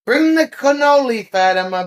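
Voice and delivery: high, loud call. Real, not acted. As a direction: call across the room to Fatima. Calling out to Fatima.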